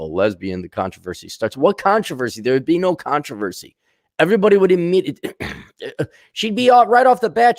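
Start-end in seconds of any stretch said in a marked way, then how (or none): 4.2-7.6 high, loud and fast; excited insisting. Real, not acted. Comic insisting she'd be out right away, high and fast. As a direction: rush it out, certain.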